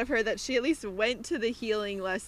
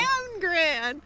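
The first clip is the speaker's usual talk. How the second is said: higher, cracking with laughter; higher laughing stumble over the name; laughing mangled name; 8 semitones higher than usual. Real, not acted. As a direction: giggle out the garbled nickname.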